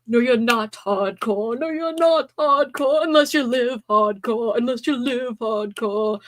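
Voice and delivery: slow, drawn-out rock teacher. Retold or imitated. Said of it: "School of Rock is a family favorite".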